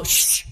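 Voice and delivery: acted hushing sound; disapproving shush. Churchgoer to someone talking in church, mock-disapproving shush. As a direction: shush them like a stern churchgoer. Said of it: "if someone dares to laugh in church".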